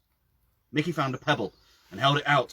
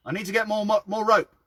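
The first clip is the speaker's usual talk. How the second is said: calling up from below; 12 semitones higher, 6 dB louder than usual. Acted. Mickey down the well shouting up to Phil, loud call. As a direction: yell it up from the bottom of the well.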